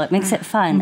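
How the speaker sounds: ordinary talk, nothing marked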